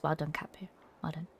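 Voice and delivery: breathy whispered well done, just above a hush. Real, not acted. Whispering praise to a viewer in chat. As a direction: whisper it like a secret compliment.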